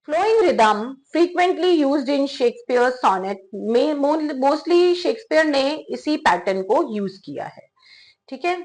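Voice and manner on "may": ordinary talk, nothing marked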